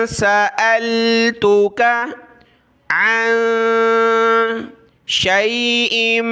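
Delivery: slow melodic chant; slow melodic Quran recitation. Shown as a demonstration. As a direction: recite like a qari, stretched out. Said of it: "reciting the ayah slowly".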